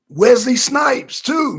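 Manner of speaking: loud slow emphatic yell; excited shout. Real, not acted. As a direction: shout the name like big news. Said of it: "excited reveal".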